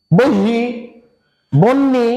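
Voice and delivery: বহি without the n, বন্নি without the h; students' mispronunciations. Shown as a demonstration. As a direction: punch out বহি, then বন্নি, as separate wrong attempts. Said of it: "কি যেন উচ্চারণ করে".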